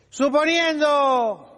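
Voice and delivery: usual speaking voice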